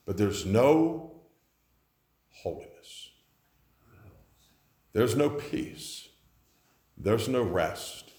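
low, soft, slow solemn preaching; hushed preaching. Acted. Preacher warning the church about faithless worship, low and solemn. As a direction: drop it down and let each word sink.

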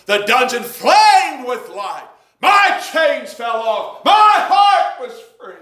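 rising slowly into a high shout; preacher building to a full shout; building preached shout. Acted. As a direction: build the hymn words to a shout. Hymn lines building to shout.

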